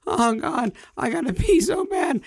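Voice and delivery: strained high-pitched moan; desperate high whine. Retold or imitated. Desperate passenger stuck in a seat, high whining moan. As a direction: squeeze it out like you're about to burst.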